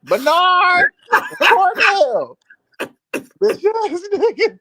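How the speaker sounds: high and loud, hyped up; excited yelling. Real, not acted. Host hollers at the caller's name, high excited yelling. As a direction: yell the names out in glee.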